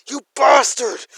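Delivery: screamed high and loud from the prison cell; furious yell. Acted. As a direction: scream it out at full force in rage. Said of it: "as he starts yelling".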